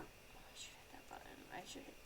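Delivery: soft whisper to herself; whispered aside. Real, not acted. Streamer reminding herself about the button, whispering. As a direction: whisper it like a private note. Muttering to self mid-game.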